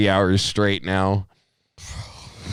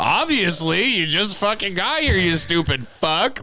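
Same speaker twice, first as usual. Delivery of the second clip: high, scornful imitation; mock exasperation; 9 semitones higher than usual. Friend mimicking a woken-up man's reply, mock-exasperated. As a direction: throw it back as if the question were idiotic.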